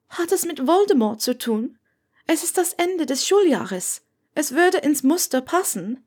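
a polite nervous student; young Hermine. A narrator's reading. Hermine voice, higher.

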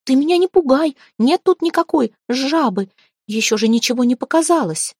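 high-pitched, nervous objection; frightened protest. A narrator's reading. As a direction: protest as a spooked girl would. Frightened girl protests being scared.

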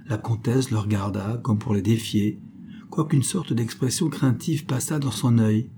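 usual speaking voice

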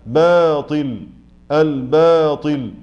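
باطل, الباطل very slow with a light ba; correct ba. Shown as a demonstration. Teacher modelling the correct باطل. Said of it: "لا".

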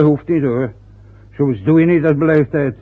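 ordinary delivery

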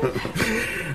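hohes böses Kichern; hohes Schurkenlachen. Acted. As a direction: lach hämisch und hoch. Bösewicht lacht. (hämisches Schurkenlachen)

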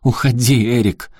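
громкий надрывный крик, выше; выкрикни сквозь рыдания; сквозь слёзы крик. Acted. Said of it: "Внезапно Аляска разрыдалась". (крик Аляски в слезах, громко)

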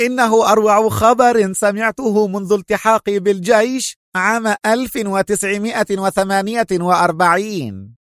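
high, thrilled voice; trembling excitement. A narrator's reading. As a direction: gush with shaky excitement. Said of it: "وانتقل الارتعاد إلى صوته".

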